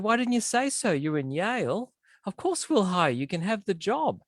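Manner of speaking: high-pitched, delighted mock interviewer; impressed interviewer. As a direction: act out an interviewer suddenly won over. Impressed interviewer acted in a joke.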